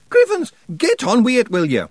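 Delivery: high, loud Scots shout; impatient Scottish Feegle. Acted. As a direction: hurry him along. Rob Anybody speaking.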